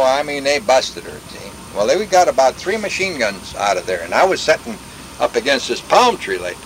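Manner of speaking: ordinary delivery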